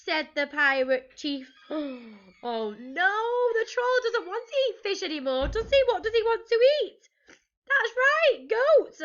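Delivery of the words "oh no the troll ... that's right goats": high, loud, animated teacher voice; playful alarm. Pretend. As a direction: build from mock dismay to glee. Teacher reacts playfully to story twist.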